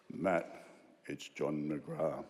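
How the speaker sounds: low, slow, grave boss voice on the phone; imitating the boss. The boss John McGrath calling Matt, low and slow. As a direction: deepen and slow it like a godlike caller.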